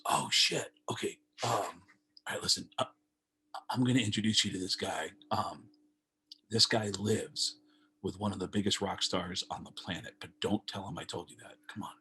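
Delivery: sneaky backstage confidence; hushed secret. Retold or imitated. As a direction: lean in and whisper the secret.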